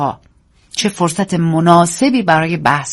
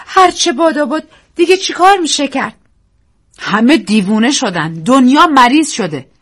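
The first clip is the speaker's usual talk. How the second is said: despairing; 7 semitones higher, 4 dB louder than usual. Acted. Daisy despairing.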